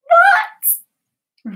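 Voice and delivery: loud, high-pitched incredulous squeak; very high yelp of shock; shocked disbelief. Real, not acted. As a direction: squeal it, scandalised by the news. Shocked at claim about [name].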